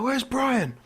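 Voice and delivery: high, lightly mocked old-person query; worried pensioner. Old people missing their friend during zombie attack, mock worry. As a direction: ask it in a put-on fretful voice.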